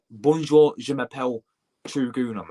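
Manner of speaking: French in a blunt English accent, punched first word. Shown as a demonstration. Streamer showing how not to sound in French exam, English accent. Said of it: "you saying French and English".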